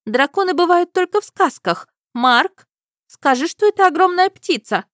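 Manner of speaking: high, loud pleading princess; disbelieving princess. A narrator's reading. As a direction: insist it's a bird.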